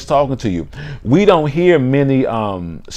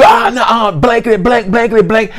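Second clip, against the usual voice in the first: high-pitched mock cursing rant; angry outburst; 10 semitones higher than usual. Hothead overriding the conscience, high angry outburst. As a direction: shout censored swearing over your conscience.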